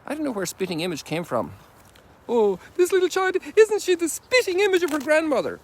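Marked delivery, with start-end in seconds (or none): 2.3-5.6 much higher, louder mock granny voice; gushing old lady. An onlooker admiring a little child, in a high gushing mock voice. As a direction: coo over the baby like a doting neighbour.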